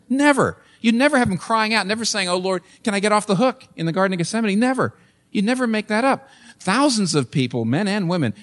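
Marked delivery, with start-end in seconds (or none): 0.1-6.3 "Never" hit high and hard each time; emphatic never. Real, not acted. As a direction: pound every "never" up high. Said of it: "you would never in a million years".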